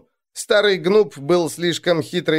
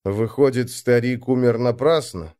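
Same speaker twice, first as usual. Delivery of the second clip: ordinary delivery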